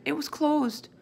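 high, lightly acted child's cry; upset cry. A narrator's reading. Sophie insists to her father, mildly crying. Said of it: "Sophie cried".

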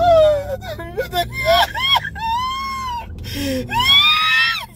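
long high-pitched sobbing wail; high crying wail. Real, not acted. Recalling the meeting, a long high sobbing wail. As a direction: let out a long tearful wail.

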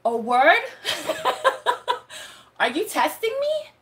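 high, loud, laughing disbelief; half-laughing question to God; laughing challenge to God. Retold or imitated. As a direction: laugh it off while asking. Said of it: "And I was like".

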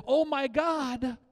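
high, drawn-out mock gasp of recognition; mock exclamation. Preacher showing what nobody will say before God, mock exclamation. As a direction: stretch it out, flippantly overdone. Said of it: "you're not gonna do this".

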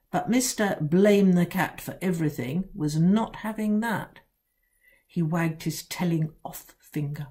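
plain reading voice

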